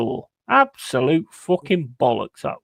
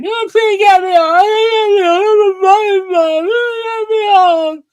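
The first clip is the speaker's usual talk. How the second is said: high, loud, drawn-out wail; mocking crybaby; 6 dB louder, slower than usual. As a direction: whine it out like an exaggerated crybaby. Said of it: "Little crybaby sits in chat going".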